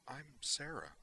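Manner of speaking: put-on a child giving her name timidly; shy little girl. Child answers the officer.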